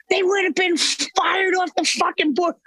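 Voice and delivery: yelling in disbelief; furious yell; shout. Real, not acted. Ranting at failed leaders.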